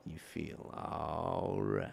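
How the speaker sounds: low, soft and very slow; quiet drawn-out words. Acted. Game master tells what is felt deep down, hushed and slow. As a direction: let each word sink and stretch.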